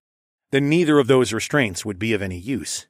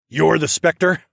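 growl; 5 semitones higher, 5 dB louder, slower than usual. A narrator's reading. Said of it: "I growled".

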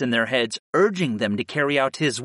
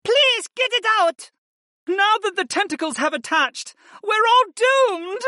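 very high, panicked wailing; high female voice in hopeless distress; despairing moan. A narrator's reading. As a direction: wail that all is lost. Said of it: "Viopa moaned".

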